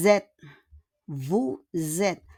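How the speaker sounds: normal talking voice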